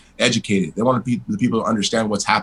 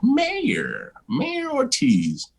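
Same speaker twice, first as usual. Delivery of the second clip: much higher, very slow, drawn out with relish; savoring the title; 3 dB quieter, much slower than usual. Retold or imitated. Trying out being called mayor, slow and savoring. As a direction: roll the title around like tasting it.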